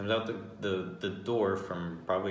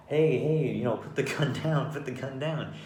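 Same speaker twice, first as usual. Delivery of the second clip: higher-pitched, urgent appeal; retold urgent calling out; 6 semitones higher, 4 dB louder than usual. Bystanders telling an old lady to drop the gun, urgent. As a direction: call out to defuse it, imitating alarmed bystanders.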